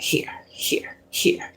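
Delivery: soft whispered offer, barely voiced. God slipping her blessings, whispered. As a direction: breathe it out like a secret gift. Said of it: "it's like God, like".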